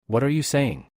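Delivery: plain reading voice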